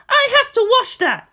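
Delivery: very high, loud scolding; angry shout. A narrator's reading. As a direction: scold him at full voice. Aunt shouting at boy.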